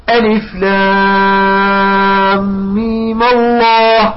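long held "لام", shorter "ميم", heavy "الله"; joined recitation. Shown as a demonstration. Teacher recites third reading, drawn-out "لام" and thick "الله". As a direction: stretch "لام" on one note, then link "mima-lloh". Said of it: "وهكذا تقرأ".

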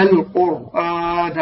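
slow "القرآن" with a thick ra after "qu"; heavy ra. Shown as a demonstration. Teacher models a Quranic word for students, heavy ra. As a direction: draw out "القرآن", ra full and heavy. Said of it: "هكذا".

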